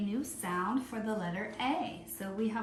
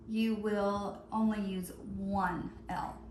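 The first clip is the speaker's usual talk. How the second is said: ordinary talk, nothing marked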